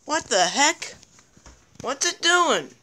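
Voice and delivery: ordinary delivery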